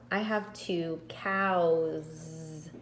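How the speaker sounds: slow, drawn-out cows with its Z ending; stressing the plural. Shown as a demonstration. Teacher modelling the plural Z sound, slowly. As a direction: stretch cows so the Z is heard. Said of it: "this makes a S, Z".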